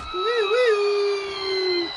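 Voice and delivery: long high-pitched loud woo; high whooping. Pretend. Commentator after the weirdest thing ever, high whoop.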